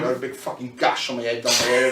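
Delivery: ordinary delivery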